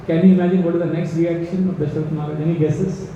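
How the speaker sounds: ordinary talk, nothing marked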